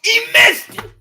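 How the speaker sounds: very high, loud cry of disbelief; real high-pitched sports outcry; dismayed shout. Real, not acted. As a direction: yelp it as the kick misses. Live reaction to missed field goal.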